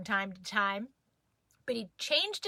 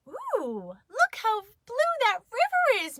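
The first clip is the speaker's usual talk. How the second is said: a high, lilting mom voice, a little hammed up; Mama cooing over the dog, pitched way up; Mama's voice; 11 semitones higher than usual. A narrator's reading.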